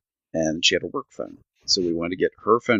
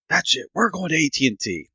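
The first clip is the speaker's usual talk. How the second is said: hammed-up fed-up declaration, higher; mock outburst; 9 semitones higher than usual. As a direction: storm off in a half-joking huff. Retold outburst at the store.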